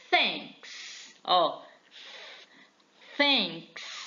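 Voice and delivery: thanks said clearly, stressing the "th"; model pronunciation. Shown as a demonstration. Teacher modelling thanks for students, slow and clear. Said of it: "word list".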